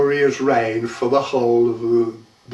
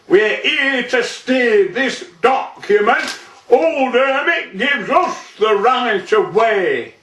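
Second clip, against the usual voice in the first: higher-pitched, declaimed official announcement; officious sergeant; 11 semitones higher, 3 dB louder than usual. A narrator's reading. Sergeant shows his permit to the farmer, officious. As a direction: announce your authority as a sergeant.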